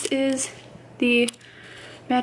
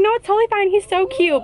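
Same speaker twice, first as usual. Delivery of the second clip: sweet clerk; 7 semitones higher than usual. Retold or imitated. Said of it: "And she's like".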